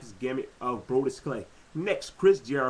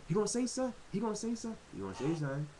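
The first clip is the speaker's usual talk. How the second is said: higher, softer eager fan voice, fading away; imitating eager fans; 5 semitones higher, 8 dB quieter, much faster than usual. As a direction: wonder aloud like a fan online, trailing off. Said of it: "People on Twitter".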